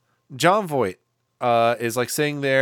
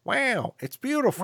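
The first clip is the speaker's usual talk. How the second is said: high, softer catchphrase impression; Owen Wilson; 9 semitones higher, 4 dB quieter than usual. Boyfriend echoing the sound girl's awe, actor impression. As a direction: echo her in a swooping actor impression. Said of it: "And he's like".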